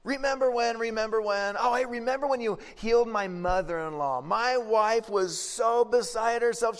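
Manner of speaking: high, eager, tumbling reminiscence; excited Peter. Retold or imitated. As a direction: gush like an excited old friend. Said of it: "and he goes".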